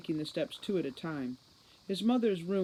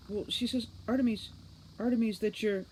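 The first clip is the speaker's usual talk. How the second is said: higher, flustered stammer; 6 semitones higher than usual. Acted. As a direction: trip over the words in a rush. Flustered maid stammering news.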